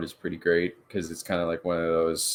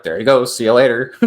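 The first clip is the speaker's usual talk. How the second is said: loud, raised call of amazement; mock-commentary excitement, louder; excited exclamation; 3 semitones higher, 10 dB louder than usual. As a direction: call it out as he takes off. Acting out amazement at skater's speed.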